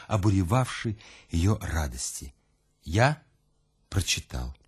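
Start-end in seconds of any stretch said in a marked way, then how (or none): none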